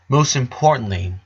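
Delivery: plain reading voice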